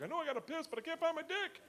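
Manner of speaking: high-pitched, hammed-up drunken wail. Very drunk man needing to piss, high comic distress. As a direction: whine it helplessly like a drunk, voice way up. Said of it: "You ever been so drunk".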